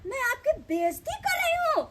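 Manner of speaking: high, shouted indignant question; indignant outburst. Acted. Quarrel, denies insulting host. (तमसा कऽ चिकरैत सवाल)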